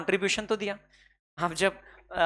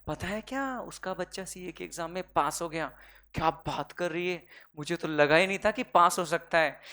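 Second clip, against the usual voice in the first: softer, hushed gossiping neighbour; mock gossip; 4 semitones lower, 6 dB quieter than usual. Teacher imitating gossiping women swapping news, hushed. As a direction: lean in and spread the news in a hush. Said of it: "जो लड़कियां करती हैं".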